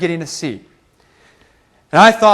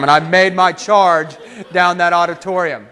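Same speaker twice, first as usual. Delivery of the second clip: ordinary talk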